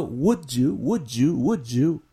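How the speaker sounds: "would you" drilled as "wood-joo", each one separate; linked with j sound. Shown as a demonstration. Teacher reviewing the sound at lesson's end, "would you" as "wood-joo". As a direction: repeat "would you" evenly, blending d and y into j. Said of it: "Y el sonido".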